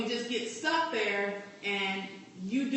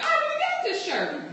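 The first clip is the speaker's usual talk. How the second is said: mock shopper. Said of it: "get home, be like".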